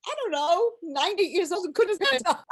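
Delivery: high, helplessly amused, real laugh; tickled, laughing up into a high voice; laughing while talking. Real, not acted. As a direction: talk through a fit of laughter. Cracks up at the memory.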